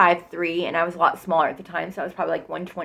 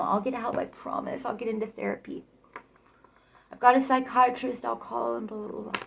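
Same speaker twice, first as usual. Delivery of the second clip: mock promises; 5 semitones higher, 6 dB quieter than usual. Imitating boyfriend's mumbled promises.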